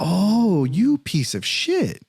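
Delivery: slow, high, drawn-out retold outrage; acting out a long, rising-to-the-boil comeback; indignant insult. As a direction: stretch the insult out in exasperated disgust. Acted insult to the officer.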